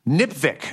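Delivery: "nipvic" as one two-syllable word, rhyming with lipstick; acronym said as word. Shown as a demonstration. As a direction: say "nipvic" as a word, NIP-vick, not spelled out. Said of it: "a way to pronounce N P V I C".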